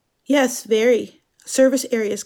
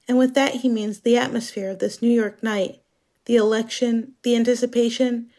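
usual speaking voice

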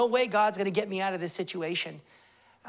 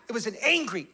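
ordinary talk

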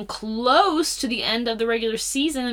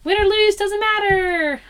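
high, loud singsong squeal of glee; carefree little cheer, pitched way up and drawn out at the end; giddy mock glee; 12 semitones higher, 6 dB louder than usual. Small bettor cheering a twenty-dollar scratch-off, giddy mock glee.

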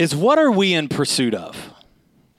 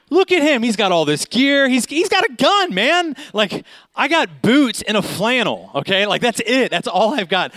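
high-pitched, louder mock panic; comic panic; 8 semitones higher, 3 dB louder than usual. Ride-along guest comparing himself to armed officer, comic panic. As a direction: retell your alarm at being hopelessly underequipped.

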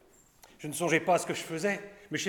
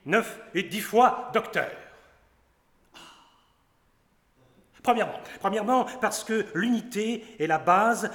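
boastful doctor; 5 semitones higher than usual. Acted. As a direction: trumpet each numbered title like a vain pedant. Doctor boasts his titles.

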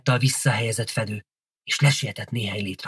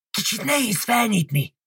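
high, strained complaint; groaning with effort; 11 semitones higher than usual. A narrator's reading. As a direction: groan it while heaving at a heavy grate. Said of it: "nyögte Gilda a csatornát fedő rácsnál".

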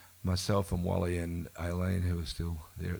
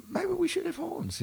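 high, innocent suggestion; mock casual. Singer teasing the bandmate about horns, high faux-innocent suggestion. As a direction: float the idea in a high, falsely naive voice. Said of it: "I said".

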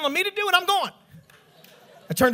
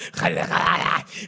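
low rasping mock devil noise; hammed-up possessed growling; demonic growl; 7 semitones lower than usual. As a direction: rattle out a throaty demon snarl. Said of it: "from the bowels of Beelzebub".

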